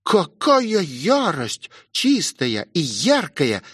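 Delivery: медленно, нараспев, с издёвкой; растягивай слова, смакуя насмешку; усмешка. A narrator's reading. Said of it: "усмехнулась гончая". (насмешливо, врастяжку)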